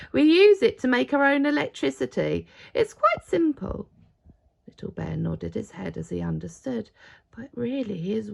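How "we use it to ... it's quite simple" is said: loud raised voice over machines; shouting. Acted. Father Christmas explains electricity, shouting over the machines. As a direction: keep shouting above the din. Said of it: "he shouted".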